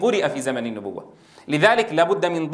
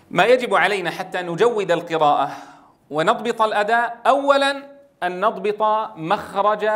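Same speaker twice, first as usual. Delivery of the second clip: normal talking voice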